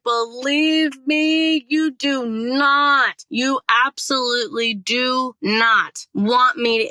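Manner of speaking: slow, high, every word leaned on; emphatic warning. Retold or imitated. Warning the court not to pick her, slow emphatic insistence. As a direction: warn them word by word. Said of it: "Like, I was like".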